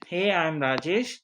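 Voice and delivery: usual speaking voice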